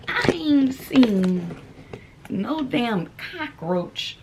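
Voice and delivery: drawn out slowly, word by word; slow emphasis. Real, not acted. Telling viewers she's seen no roach all year, slowly. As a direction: lay each word down slowly for weight. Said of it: "emphatic slow statement".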